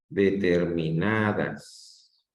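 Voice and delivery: "Determinadas" low and slow, lifting on long "na"; stressed syllable drawn out. Shown as a demonstration. Teacher asking which syllable is strongest, "Determinadas" stressed on "na". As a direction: stretch de-ter-mi-NAAA-das, falling at the end. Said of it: "Dónde está la sílaba de mayor intensidad".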